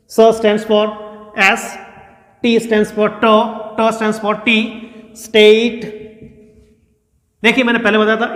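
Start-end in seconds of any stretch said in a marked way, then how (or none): none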